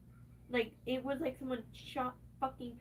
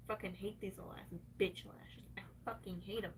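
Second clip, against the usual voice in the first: muttered whisper; 5 semitones lower, 6 dB quieter than usual. Real, not acted. Grumbling at lashes.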